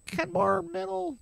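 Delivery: put-on high, small child's voice; the boy telling the cops his school in a tiny high voice; meek kid voice. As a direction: squeak it out like a scared thirteen-year-old. His younger self answering cops.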